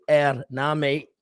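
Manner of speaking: lightly voiced Yorkshire accent; a hint of Yorkshire in a quoted retort. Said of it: "That would be in a Yorkshire accent".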